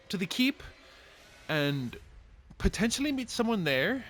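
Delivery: very high, loud sing-song greeting; cheery shopkeeper. Acted. Dwarf shopkeeper greets the party in a high voice. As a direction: greet the customer bright and squeaky. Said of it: "And she says".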